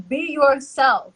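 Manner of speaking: ordinary talk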